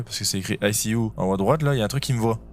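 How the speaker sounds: ordinary talk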